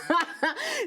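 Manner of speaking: high bright laugh; burst of laughter. Real, not acted. Guest laughing about calling him a boy, real laugh. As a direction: laugh out at your own joke.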